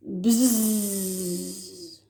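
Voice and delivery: low buzzing like a bee. Shown as a demonstration. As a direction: buzz like a bee flying off. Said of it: "use intonation and role play".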